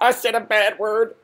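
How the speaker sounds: high, drawn-out mock confession; mock announcement. Mock confession repeated for laughs.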